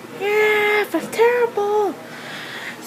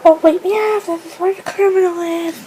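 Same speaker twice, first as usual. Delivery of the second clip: usual speaking voice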